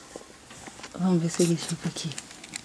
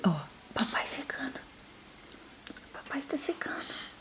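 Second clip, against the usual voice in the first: whisper; 5 semitones higher, 14 dB quieter than usual. Real, not acted. Whisper to baby.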